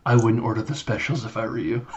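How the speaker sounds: understated dry quip; casual aside. Retold or imitated. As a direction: toss it off softly to the diners. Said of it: "casually is like".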